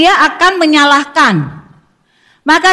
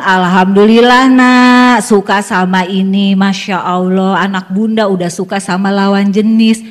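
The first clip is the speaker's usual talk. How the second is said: gently thankful, like cradling the news; warm gratitude; 6 semitones lower than usual. Shown as a demonstration. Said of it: "Kita bilang".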